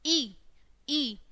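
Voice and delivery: "ई" said short and quick; short vowel. Shown as a demonstration. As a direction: keep each "ई" small and brief. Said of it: "जल्दी से छोटा सा ई बोलना". ("ई" को छोटा, जल्दी से बोलना)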